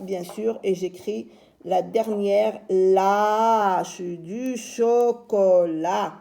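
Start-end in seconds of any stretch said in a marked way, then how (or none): 2.7-6.1 "chocolat" very slow, every syllable held; syllabes étirées. Shown as a demonstration. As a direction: draw out "lâche du chocolat" syllable by syllable. Teacher sounds out the whole word. (« chocolat » très lent, syllabes allongées)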